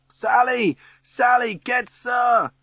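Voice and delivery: high, loud call for help; urgent shouting. Acted. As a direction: shout across the crowd to a friend. Calling Sally to fetch the teacher.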